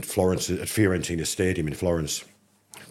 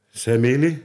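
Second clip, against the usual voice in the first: teasing, louder and higher Italian question; smug gloating ask in Italian; cheeky taunt; 7 semitones higher, 7 dB louder, slower than usual. Retold or imitated. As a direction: rub it in with a grin. Teasing the footballer.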